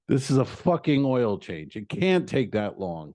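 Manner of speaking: ordinary talk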